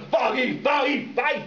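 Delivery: retold shouting match in the street; mock angry shout. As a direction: hurl the insult over and over. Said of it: "Yeah, like that".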